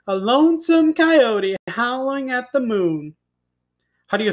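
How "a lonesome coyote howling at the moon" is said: a hint of coyote howl in the narration; lightly voiced lonesome howl. A narrator's reading. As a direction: draw the words out high, lightly howled. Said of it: "in a voice that sounded like".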